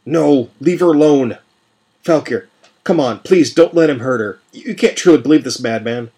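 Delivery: loud, high desperate plea; desperate pleading shout. Acted. Prisoner begging his old ally to save her, shouting. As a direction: beg at the top of your voice. Jack pleads as Penny threatened.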